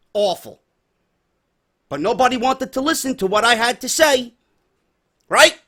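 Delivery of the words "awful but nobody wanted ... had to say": ordinary talk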